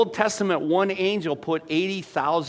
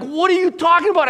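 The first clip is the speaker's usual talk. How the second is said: much higher, louder, fast disbelief; coworkers' retold disbelief, loud and high; baffled outcry; 10 dB louder, faster than usual. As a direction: blurt it in total confusion.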